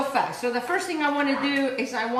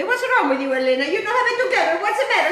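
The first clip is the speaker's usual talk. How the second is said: higher-pitched teasing self-scolding; mock scolding herself; 5 semitones higher, faster than usual. Cook scolding herself over the forgotten step, mock stern. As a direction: tease yourself like a scolding friend. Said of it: "Let me have a conversation with myself".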